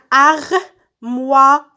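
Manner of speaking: armoire very slow, in separate beats; syllable by syllable. Shown as a demonstration. Teacher cutting words into syllables, syllable by syllable. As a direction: draw out armoire as AR, MOI, RE with gaps. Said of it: "je découpe les mots en syllabes".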